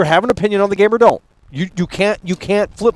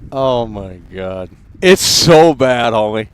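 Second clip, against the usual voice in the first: low slow groaned oh my God; low slow exasperated groan; 7 semitones lower, slower than usual. Real, not acted. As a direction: groan it out slowly. Exasperated at trailer.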